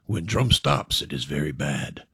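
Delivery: low, hushed voice of doom; ominous warning. Acted. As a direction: murmur it like a dark omen. Bar stranger's ominous warning.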